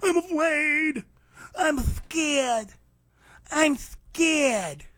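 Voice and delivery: high, whimpering mock fear; mock a cowering coward, high and slow; mocking scared puppy. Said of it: "like a scared puppy".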